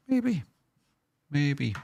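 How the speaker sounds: very high squeaky maybe; squeaky teasing voice. Acted. Teasing about chopping Corey's head.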